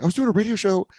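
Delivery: frantic explanation. Retold or imitated. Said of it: "And I was like".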